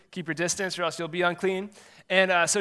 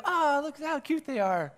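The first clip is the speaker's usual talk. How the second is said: cooing spectator; 8 semitones higher than usual. Retold or imitated. Spectators cooing at the coach's kids, high mock gush. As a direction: coo over the kids like a doting fan. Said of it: "And they go".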